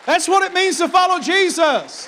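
loud, high, ringing preacher's cry; shouting celebration preaching; preaching at full force. Acted. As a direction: preach it out at the top of the lungs.

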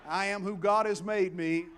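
loud, rallying preacher call; preaching at full force. Real, not acted. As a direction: lead the congregation in a bold declaration. Rallies congregation to repeat declaration.

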